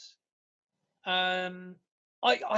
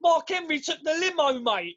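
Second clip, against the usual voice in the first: higher, cheeky cab-driver geezer voice; London geezer; 7 semitones higher, 3 dB louder than usual. Retold or imitated. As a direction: play the geezer shrugging it off. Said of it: "And he goes".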